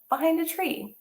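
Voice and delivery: ordinary delivery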